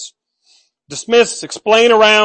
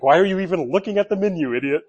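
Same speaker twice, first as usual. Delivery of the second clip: teasing wife; 6 dB quieter, faster than usual. Retold or imitated. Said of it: "Christy laughs at me".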